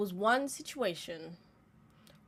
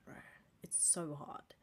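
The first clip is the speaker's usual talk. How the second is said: soft whispered admission; 6 semitones lower, 15 dB quieter than usual. Real, not acted. Admitting to her viewers in a whisper how hard forgiving is. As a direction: breathe it out as a whisper.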